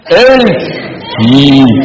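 put-on French accent. Shown as a demonstration. Leader demonstrates the slogan for the group in a French accent. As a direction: say it like a French speaker. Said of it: "In a French accent".

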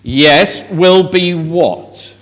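usual speaking voice

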